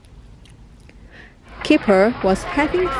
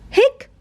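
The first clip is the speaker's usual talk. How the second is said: sharp high hiccup sound; a little squeaky hic; 13 semitones higher than usual. Acted. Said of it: "said Keeper".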